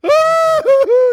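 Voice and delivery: loud, very high drawn-out comic wail; mock wail. Preacher imitating his uncle crying over the deer, mock wailing. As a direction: imitate someone bawling at full volume. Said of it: "but he's wailing, wailing".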